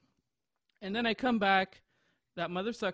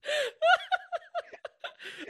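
long helpless squealing laugh; high laughter; 3 dB louder than usual. Real, not acted. Cracking up mid-story to a friend, high helpless laugh. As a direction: lose it completely, high and giggly.

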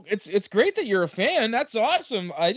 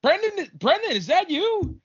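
calling out; 6 semitones higher, 4 dB louder, slower than usual. Acted. Waking up in the dark, calling out.

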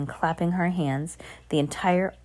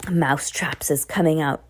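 ordinary delivery, nothing marked

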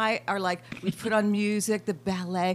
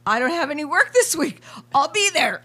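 higher, louder eager reply; eager yes; 6 semitones higher, 8 dB louder than usual. Retold or imitated. Telling the couple he'll be there, eager. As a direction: jump at the invite. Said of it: "and I go".

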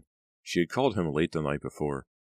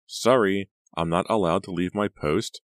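mock-polite brush-off; 4 semitones higher, 6 dB louder than usual. Clerk brushes off again.

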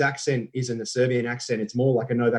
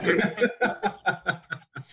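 laugh; 8 semitones higher than usual. Real, not acted. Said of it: "[name]'s lost it".

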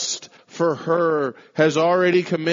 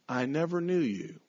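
much softer, sorrowful hush; hushed verdict; 9 dB quieter than usual. Acted. Preacher reads Jesus's final words to false followers, hushed. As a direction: let the words fall quietly.

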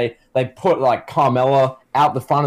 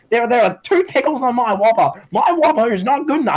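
very high, drawn-up mock complaint; whining Karen; 10 semitones higher than usual. Karen complaining about her Whopper, hammed-up high whine. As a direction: imitate an outraged Karen in an exaggerated high voice. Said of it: "Karen's just like".

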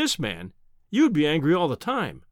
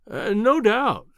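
slow, drawn-out placating agreement, higher on "no doubt"; humoring; 6 semitones higher, 4 dB louder, much slower than usual. A narrator's reading. Narrator humors the professor about his sketch, slow and patronizing. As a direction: humor him as if he were a fool, stretching "no doubt". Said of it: "as one humors an imbecile".

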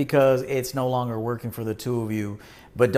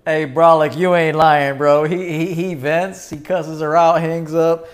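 loud, higher, animated storytelling; hyped commentary; 4 semitones higher, 6 dB louder than usual. Real, not acted. Radio host riffing to his cohost, loud and hyped. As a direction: pump up the story.

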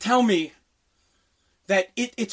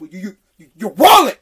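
imitated stammering threat; 2 semitones lower, 10 dB louder than usual. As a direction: stammer on "you", then explode on "wallet". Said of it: "He said it just like that".

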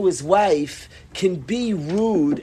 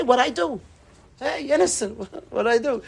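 higher voice, hammed-up innocent act; mock innocence; 5 semitones higher than usual. Husband denying any fault to his wife, mock innocence. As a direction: play the blameless husband.

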